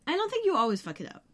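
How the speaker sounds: ordinary delivery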